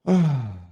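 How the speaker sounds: loud, high exhaled sigh; heavy sigh. Real, not acted. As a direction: let out a big audible sigh. Reaction to emotional account.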